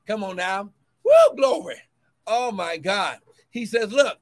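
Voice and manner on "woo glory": high, loud whooping praise; joyful church whoop; ecstatic praise shout. Real, not acted. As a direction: let the glory burst out.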